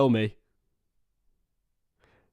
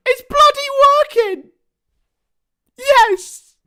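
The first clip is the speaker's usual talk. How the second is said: real delighted yell, much higher and louder; excited; 10 dB louder, slower than usual. Real, not acted. Streamer cheering that the dead charger works, real yell. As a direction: shout it out in triumph.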